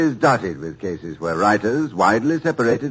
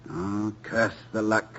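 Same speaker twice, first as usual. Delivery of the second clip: rueful sigh; 8 dB quieter, slower than usual. Acted. Husband after rejection letter.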